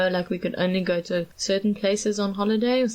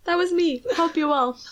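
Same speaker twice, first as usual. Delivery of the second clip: excited; 9 semitones higher than usual. Real, not acted. Calling out to old waiter.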